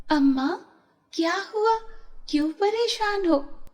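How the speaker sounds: a hint of a caring young girl; gentle young girl. Rita asks old woman kindly.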